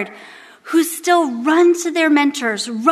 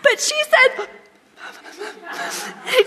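whiny mock protest; 9 semitones higher, 3 dB quieter, slower than usual. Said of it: "And I'm like".

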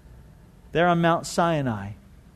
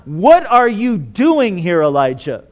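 stern, mad questioning; angry; 4 dB louder than usual. Shown as a demonstration. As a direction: demand it angrily, leaning hard on the words. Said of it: "the tone here is not angry".

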